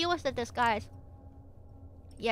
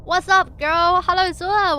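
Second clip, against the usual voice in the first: big bright hello, much louder and higher; excited greeting; 6 semitones higher, 10 dB louder, slower than usual. Real, not acted. Streamer greets a viewer who just arrived, excited and loud. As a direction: throw a loud, happy hello at the newcomer.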